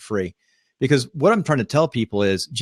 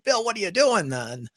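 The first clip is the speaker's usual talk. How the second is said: mock yell; 8 semitones higher, 4 dB quieter than usual. Imagining co-host yelling at him, in a high mock yell. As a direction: playfully yell it high, tailing off quieter. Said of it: "He's probably gonna yell at me".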